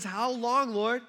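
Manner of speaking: loud, high appeal to God; acted despairing cry. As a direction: cry out the question in anguish. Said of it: "David felt depressed".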